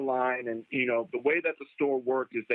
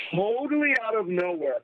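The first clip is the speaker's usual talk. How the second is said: ordinary talk, nothing marked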